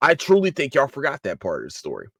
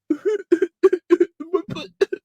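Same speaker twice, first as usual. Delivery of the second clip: high mocking laugh. Real, not acted. Laughing at own mockery.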